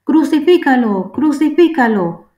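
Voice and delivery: high, loud demanding chant; crowd shouting. A narrator's reading. Priests and guards demanding crucifixion, loud high shout. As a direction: hurl it out like an angry mob.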